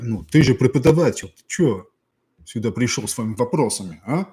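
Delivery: high, loud, pushy student voice; mock the students whining at the teacher; hammed-up grumbling students; demanding Russian students. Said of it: "No, nobody speaks like that".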